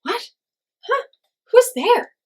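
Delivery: very high, loud startled questions. A narrator's reading. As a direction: jump at a voice in the dark. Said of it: "said Coyote Man".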